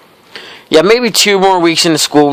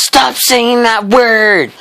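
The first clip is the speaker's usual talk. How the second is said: much higher, very slow shrieked mock protest; hammed-up outburst, much higher; mock freak-out; 9 semitones higher, much slower than usual. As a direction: imitate the kid flipping out. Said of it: "this kid flips out".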